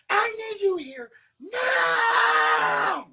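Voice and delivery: high, loud, slowing into crying shouts; fake car crash panic. Derek showing the dramatic rescue call, fake sobbing panic. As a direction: play a sobbing crash victim begging for help. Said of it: "Okay, go ahead".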